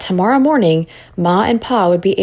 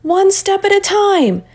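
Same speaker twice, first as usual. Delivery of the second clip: shouting; 13 semitones higher than usual. A narrator's reading. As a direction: push the children on. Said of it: "Miss Freeman shouted".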